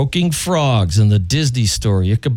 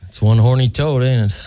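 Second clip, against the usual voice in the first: usual speaking voice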